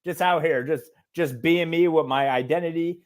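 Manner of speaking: usual speaking voice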